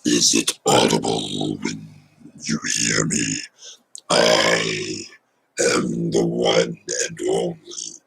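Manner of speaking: very low, slow, breathy possessed growl; demon voice. Shown as a demonstration. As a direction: speak like a demon taking over. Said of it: "I can do a demon voice".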